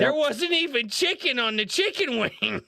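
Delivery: high, emphatic disbelief; retold incredulous protest. Manager refusing a chicken-wing refund, retold incredulity.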